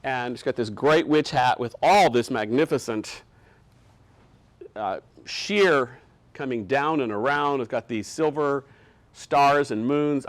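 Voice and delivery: ordinary talk, nothing marked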